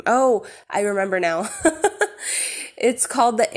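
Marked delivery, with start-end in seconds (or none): 1.5-2.7 breathy laugh. Real, not acted. Laughing while recalling title.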